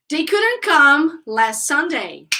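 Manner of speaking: slow drill sentence, words set apart; unhurried pronunciation model; repeat-after-me model. Shown as a demonstration. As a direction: say it slowly for the class to copy. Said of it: "So, repeat".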